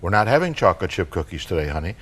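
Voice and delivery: even, understated mom voice; matter-of-fact. Acted. Mom answers her four-year-old about cookies, matter-of-fact. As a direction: say it plainly, without fuss. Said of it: "Very matter-of-factly, Mom says".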